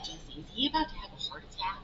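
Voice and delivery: soft, fast worried aside; hushed whisper. Retold or imitated. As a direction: whisper quickly to your neighbor. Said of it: "and I'm like".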